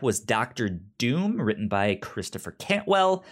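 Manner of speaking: ordinary delivery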